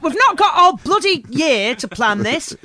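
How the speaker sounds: brisk, nagging planner voice; a pushy northern wedding planner; bossy northern planner. Pretend.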